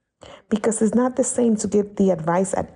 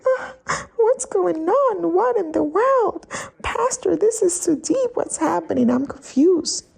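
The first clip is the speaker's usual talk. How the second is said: much higher, whiny mock-bewildered voice; confused believer; 10 semitones higher than usual. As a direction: play a flustered follower pleading with the pastor. Imitating confused church member.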